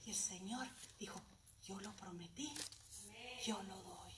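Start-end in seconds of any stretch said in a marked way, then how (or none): 1.6-4.1 hushed, soft divine answer; whispered voice of God. Retold or imitated. The Lord answering the disciples, in a hushed whisper. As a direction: breathe it like a sacred promise. Voicing the Lord's reply.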